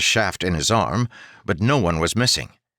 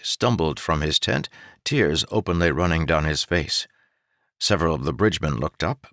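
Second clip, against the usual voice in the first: plain reading voice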